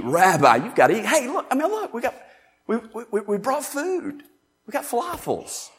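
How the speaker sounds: high, excited coaxing voice; eager disciples. Retold or imitated. Disciples coaxing Jesus to eat, high and eager.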